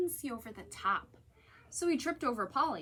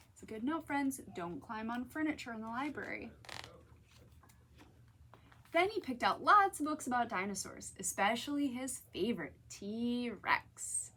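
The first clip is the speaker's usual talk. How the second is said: plain reading voice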